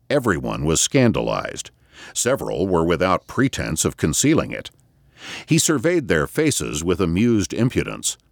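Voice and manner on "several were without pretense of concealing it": usual speaking voice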